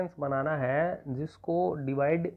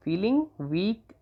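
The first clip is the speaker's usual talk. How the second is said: "feeling weak" drawn out very slowly, ending on a rise; very slow repeated dictation of "feeling weak"; 3 semitones higher, much slower than usual. Shown as a demonstration. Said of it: "तो आप लिख देंगे feeling".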